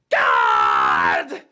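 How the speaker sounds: ordinary delivery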